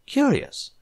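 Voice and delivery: very high, drawn-out musing; musing doctor. A narrator's reading. Doctor pony examining the human, high musing. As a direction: savour the word.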